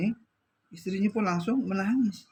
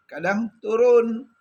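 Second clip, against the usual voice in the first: kadang turun slow and much louder, each word leaned on; emphatic stress; 5 semitones higher, 9 dB louder, much slower than usual. Shown as a demonstration. Preacher telling the congregation faith goes up and down, slow loud emphasis. Contrasting faith rising and falling.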